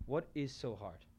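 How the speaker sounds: softly repeated rhetorical question. Shown as a demonstration. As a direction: drop the voice and ask it to yourself.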